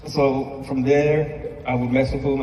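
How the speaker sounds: ordinary talk, nothing marked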